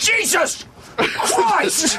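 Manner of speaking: high, very slow, hammed-up oath; mocking the guy. Friend mimics the guy's reaction at airport, high drawn-out mock oath. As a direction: stretch it out mocking him. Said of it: "the guy was like".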